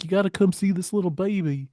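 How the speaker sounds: mock childlike excitement; excited child. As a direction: lightly imitate a child eager to show off. Acts out excited child finding baby.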